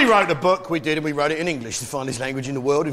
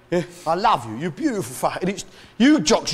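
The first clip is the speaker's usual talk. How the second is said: ordinary talk, nothing marked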